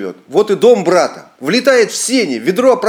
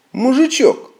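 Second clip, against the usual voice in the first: gentleman's address; 10 semitones higher, slower than usual. A narrator's reading. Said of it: "говорит барин".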